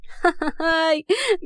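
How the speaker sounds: high laugh falling away; scornful laugh. A narrator's reading. Rahaf scoffs at Murad saying he loves her, laughing. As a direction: laugh off his declaration.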